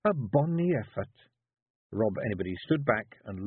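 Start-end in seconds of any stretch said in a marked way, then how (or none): 0.0-1.1 high Scottish Feegle woman coaxing; wife coaxing her husband into writing. A narrator's reading. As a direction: push your husband gently, high and Scots. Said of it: "she said".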